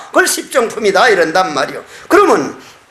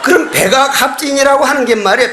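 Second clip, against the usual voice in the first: ordinary talk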